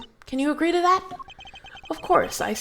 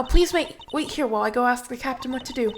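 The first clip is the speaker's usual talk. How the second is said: ordinary talk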